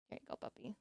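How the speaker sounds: soft whispered words to the puppy; whisper to dog. Real, not acted. Handing the puppy a snack, whispering.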